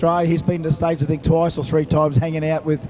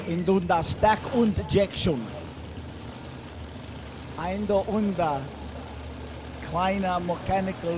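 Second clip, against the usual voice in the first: softer, hammed-up German accent; mock German accent; 8 dB quieter than usual. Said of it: "don't speak German".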